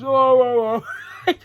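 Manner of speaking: loud, high mock protest breaking into laughter; hammed-up imitation, high and loud; Tekla. Fan mimics the wrestler interrupting, high loud impression. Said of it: "And she goes".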